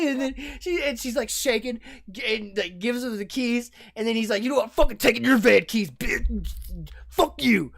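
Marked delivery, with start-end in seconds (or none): 4.4-7.7 loud, fast mock tough-guy taunt; robber snarl. Robber taunts the clerk while taking keys, a loud mock threat. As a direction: spit it at the clerk.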